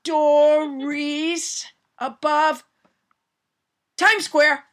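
slow strained words, wobbling. Pretend. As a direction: stretch each word while balancing. Pretend balancing on tightrope.

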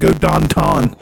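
Pittsburgh accent, downtown said as Don Ton. Shown as a demonstration. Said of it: "That's how they say downtown".

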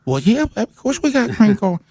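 waitress voice. Retold or imitated. Waitress offering fried chicken to caller, high and doting. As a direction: dote on him like a favorite customer. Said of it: "hear her voice saying to me".

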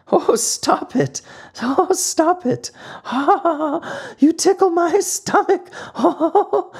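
very high giggly squeal, being tickled; tiny voice giggling while being tickled. A narrator's reading. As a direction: giggle it out like a ticklish little puppet. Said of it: "it giggled as it spoke".

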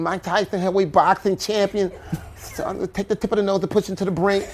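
high, lisping boxer voice; light, high champion impersonation; Mike Tyson. Acted. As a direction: pitch it up like the boxer.

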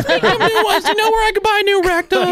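very high falsetto mom voice; Cameron's mom. Retold or imitated. Host mocking a co-host's visiting mother, falsetto mom. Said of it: "his mom was like".

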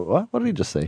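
high, baffled "What did he just say?"; puzzled listener. Retold or imitated. Imitating baffled strangers reacting to the blessing, high and confused. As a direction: mimic a confused stranger, pitch jumping up. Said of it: "you always see them take a blank stare".

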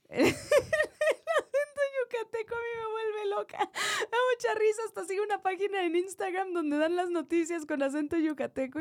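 high-pitched, laughing through the words; can barely get it out for laughing; giggling delight at the Yucatán accent. Real, not acted.

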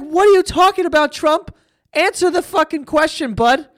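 mock call-out. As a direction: snap at him to answer, half-joking. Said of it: "It's like".

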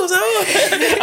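high laughing quote. Retold or imitated. Hearing the friend's name called, laughing high. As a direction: laugh through it.

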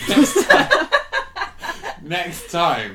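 shouted through laughter; laughing. Real, not acted. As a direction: cackle the words out. Laughing punchline.

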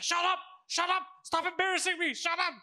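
high, exasperated retort in imitation; mock protesting yell. Son yells back at mom.

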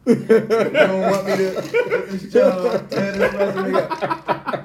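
high, slow, laughing plea to God; laughing prayer. Retold or imitated. Job seeker laughing as he asks God to tear up his resume. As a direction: beg God through laughter. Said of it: "looking back like, "Hey, God".

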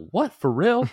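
high-pitched incredulous reaction; mock surprise. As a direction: act out amazed disbelief, pitch jumping up. Imagined disbelief at future news.

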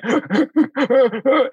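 high sharp gasp. Retold or imitated. Client gasping at bragging therapist, high. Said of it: "I'm literally going".

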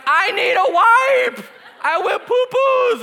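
put-on high, loud, slow child's whine; whiny little girl. Pastor imitating his daughter asking for a wipe in a high, slow, loud whine. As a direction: whine it out like a toddler.

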